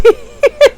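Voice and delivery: loud squealing laugh; high burst of laughter. Real, not acted. Laughing at the kids' story.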